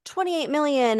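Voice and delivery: mock gushing. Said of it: "it's like".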